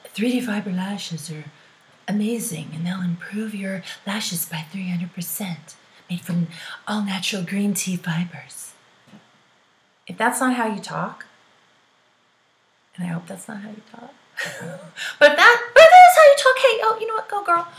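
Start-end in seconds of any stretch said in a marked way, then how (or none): 0.1-8.7 lightly voiced flat product blurb, half whispered; a hint of a sales-copy reading; stiff ad copy. Shown as a demonstration. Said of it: "Or does it just say". 15.2-17.7 excited cheer. Acted. Cheering on a sassy talker.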